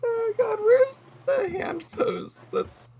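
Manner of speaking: high squeaky laugh-talk; gasping it out while cracking up. Real, not acted. Streamer looking for a hand pose, laughing helplessly.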